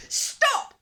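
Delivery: a sudden, very loud, high cry of Stop; commanding shout. A narrator's reading. Little fish king shouts at the dinosaur to stop. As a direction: yell it to freeze him in his tracks.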